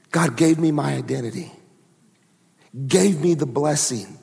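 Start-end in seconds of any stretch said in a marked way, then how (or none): none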